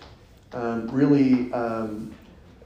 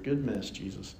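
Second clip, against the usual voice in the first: ordinary delivery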